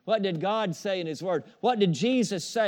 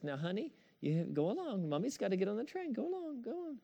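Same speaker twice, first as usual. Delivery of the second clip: sweet coaxing mother; 4 semitones higher, 9 dB quieter than usual. Retold or imitated. Lady shooing her dog.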